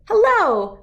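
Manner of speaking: high, bright loud greeting; cheerful chirp. A narrator's reading. Bird greets the dog in class with a high loud chirp. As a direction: sing out the hello. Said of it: "she chirped".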